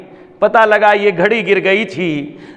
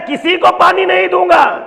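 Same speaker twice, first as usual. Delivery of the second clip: defiant shout; 10 semitones higher than usual. Acted. Preacher voicing the tyrant to the crowd, defiant shout. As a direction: bellow it like an arrogant tyrant. Enemy voiced in sermon.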